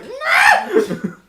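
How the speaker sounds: high laughing scream of no; laughing shout. Retold or imitated. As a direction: yell it in mock despair. Retold shout at swat.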